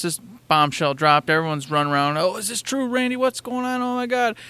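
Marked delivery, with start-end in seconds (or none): 2.2-4.4 high, soft frantic fretting; mock panic. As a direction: fret in a high voice like a rattled fan. Voicing frantic fans reacting to news.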